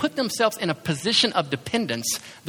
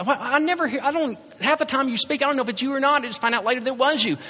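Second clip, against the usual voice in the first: griping at God; 8 semitones higher than usual. Retold or imitated. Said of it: "griping at God saying".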